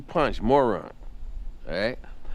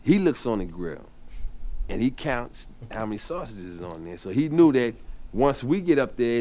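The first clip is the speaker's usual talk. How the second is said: ordinary delivery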